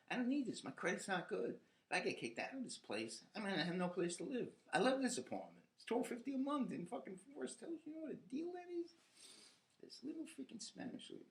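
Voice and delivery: building anger about losing the cheap apartment; rising rant. Real, not acted. Angry rant about neighbor.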